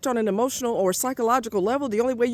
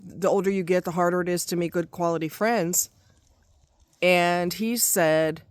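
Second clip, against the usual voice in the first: ordinary talk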